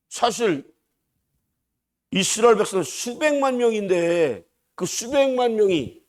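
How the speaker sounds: ordinary talk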